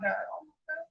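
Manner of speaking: normal talking voice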